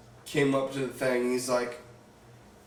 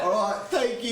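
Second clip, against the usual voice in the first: put-on higher-pitched British accent, on the edge of laughter; 8 semitones higher than usual. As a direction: keep up a fake British accent, awkwardly. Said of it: "have to keep this British accent going".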